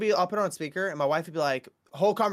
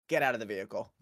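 stern cop; 5 semitones lower, 5 dB quieter, much faster than usual. Retold or imitated. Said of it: "the cop would be like".

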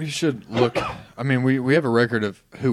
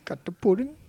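high, softer catchphrase impression; Bill Cosby; 9 semitones higher, 5 dB quieter than usual. Shown as a demonstration. Host echoes the only line he knows, Cosby voice. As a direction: echo the catchphrase high and a bit softly. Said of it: "can you do a Cosby impression".

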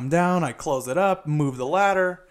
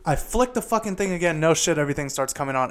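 ordinary talk, nothing marked

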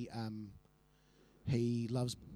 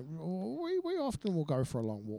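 Nigel. Acted. Telling of walks with mother, hesitant oddball voice. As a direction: stumble along as a mild oddball. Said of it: "Oh, he had a voice".

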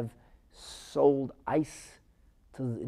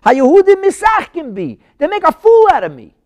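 loud, high, indignant complaint; outraged Pharaoh; 15 dB louder than usual. Acted. Pharaoh complaining that he is being mocked, loud and outraged. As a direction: cry out in wounded outrage. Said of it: "Pharaoh said".

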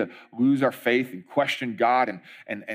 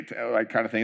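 ordinary talk, nothing marked

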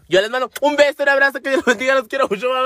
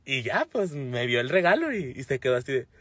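ordinary talk, nothing marked

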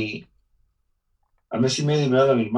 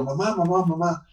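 put-on higher pleading, the boy begging his mother not to hit him; pleading child crying; 5 semitones higher than usual. Boy on his knees begs his mother, high and frightened. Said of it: "levanto mi cara para arriba llorando".

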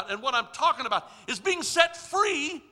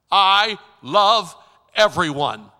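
loud, commanding voice of God; booming divine voice; 3 semitones lower, 8 dB louder, slower than usual. Acted. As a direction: proclaim it like heaven speaking. God's voice in his head.

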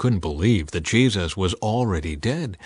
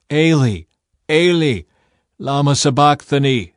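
loud, higher anguished cry; calling out loudly; 4 semitones higher, 6 dB louder than usual. A narrator's reading. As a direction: cry out with all your strength. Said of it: "called out with a loud voice".

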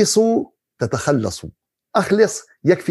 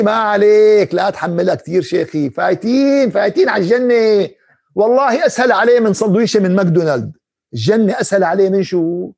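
mock someone blithely sure of himself, voice raised; brash overconfidence; 5 semitones higher, 4 dB louder than usual. Man brushing off the sheikh, sure of paradise, brash mock confidence. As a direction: imitate a cocky, breezy man, pitched up and louder. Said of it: "هاي جراءة على الله".

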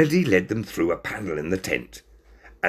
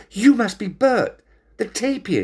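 tag; 14 semitones higher, 4 dB louder than usual. A narrator's reading.

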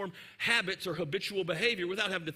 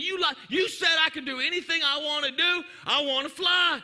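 put-on much higher, louder child's accusing yell; angry kid shouting; 7 semitones higher, 5 dB louder than usual. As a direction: scream at mom that she lied. Child yells at mother.

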